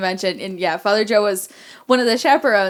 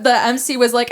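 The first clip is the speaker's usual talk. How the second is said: ordinary delivery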